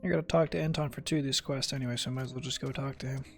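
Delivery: ordinary delivery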